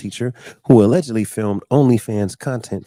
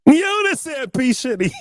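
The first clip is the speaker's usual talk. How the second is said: high mock caller. Caller voice in parody skit.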